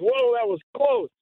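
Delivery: high-pitched startled exclamation; retold startled relief. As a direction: react to a near miss, retold. Retelling his narrow escape.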